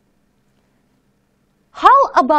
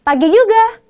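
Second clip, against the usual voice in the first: high, breezy friendly reply; casual reply; 7 semitones higher, 3 dB louder than usual. Shown as a demonstration. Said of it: "just say".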